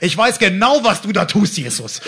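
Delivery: loud, high comic outcry; high-pitched outburst, dropping on the name; mock accusation. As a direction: call Jesus out, loudly exasperated. Acted loud complaint to Jesus.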